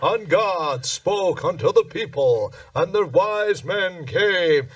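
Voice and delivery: slow, grand announcer voice imitating the show; booming it out like the nativity narrator; pompous narrator. A comedian mimics the Radio City narrator, slow and grand.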